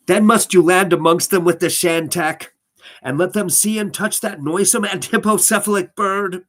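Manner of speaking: high, loud, swooping storybook voice; weird reading voice. Acted. Reader redoing the passage in the voice, weird and high. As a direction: declaim it in a weird, high-pitched voice. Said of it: "Just do the weird voice I was doing".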